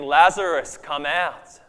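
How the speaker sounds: commanding shout, first word hit hardest; loud call. A narrator's reading. Preacher voices Jesus calling into the tomb, loud shout. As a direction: call out loudly to summon someone. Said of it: "Jesus calls out in a loud voice".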